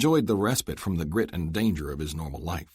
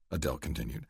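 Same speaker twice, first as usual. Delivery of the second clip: usual speaking voice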